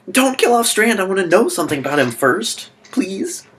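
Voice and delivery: high, loud mock pleading; panicking fan. Viewer pleading for Strand to survive, in mock panic.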